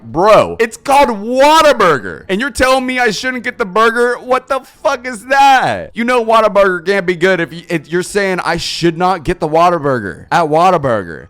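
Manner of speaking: arguing with a friend about ordering the burger; incredulous outburst. Retold or imitated. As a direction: yell it like the logic is absurd. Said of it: "I'm like".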